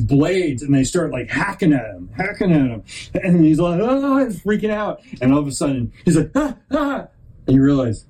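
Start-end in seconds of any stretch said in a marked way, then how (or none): 3.8-4.2 high yelp of a frightened turtle; mock panicked yelp. Storyteller voicing the netted turtle, high mock yelp. 6.3-7.1 high, short alarmed cries; mock panicked cries. Storyteller voicing the turtle being cut free, high mock cries.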